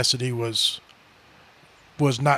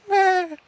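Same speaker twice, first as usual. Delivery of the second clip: high-pitched bleat; imitated eerie noise. Dad imitating the noise heard in the den, eerie bleat. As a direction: let out a high goat-like "meh". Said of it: "and I hear this".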